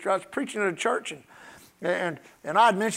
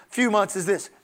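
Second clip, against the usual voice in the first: usual speaking voice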